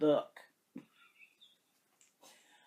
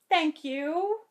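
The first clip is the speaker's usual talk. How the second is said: higher, choked sincere thanks; tearful thanks; 6 semitones higher than usual. Real, not acted. Vlogger thanking Christine on camera, tearful sincere thanks. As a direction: thank her through tears.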